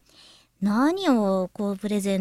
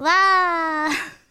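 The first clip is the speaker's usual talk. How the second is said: cheering; 8 semitones higher, 4 dB louder than usual. Real, not acted. Cheering the new corner.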